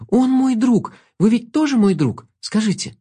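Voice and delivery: high earnest plea; pleading. A narrator's reading. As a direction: beg the wolf to agree. Girl pleads with wolf.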